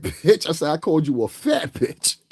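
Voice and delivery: usual speaking voice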